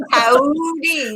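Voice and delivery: a long, drawn-out "Howdy"; mock slow Texan "Howdy"; exaggerated slow drawl. Shown as a demonstration. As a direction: stretch "Howdy" right out, both syllables long. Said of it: "No, Pam, you don't talk that slow".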